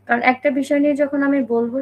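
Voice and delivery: normal talking voice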